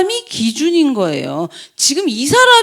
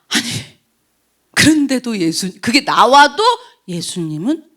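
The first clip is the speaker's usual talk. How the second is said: ordinary talk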